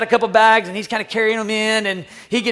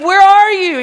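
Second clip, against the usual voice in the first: loud high shouted question; calling out; 10 semitones higher, 11 dB louder, slower than usual. Retold or imitated. Dad searching for his hidden son, shouting. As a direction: holler it across a distance.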